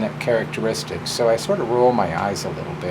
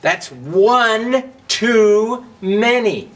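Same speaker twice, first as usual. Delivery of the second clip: very slow, loud and higher; scornful Match; 9 semitones higher, 5 dB louder, much slower than usual. Acted. Match scolding Book about two dads, slow and loud. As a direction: drag out the disapproval.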